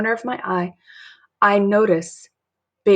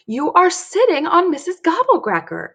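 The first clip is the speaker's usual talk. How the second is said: put-on insistent child; 10 semitones higher than usual. Dory tells dad off.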